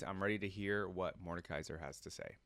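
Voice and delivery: normal talking voice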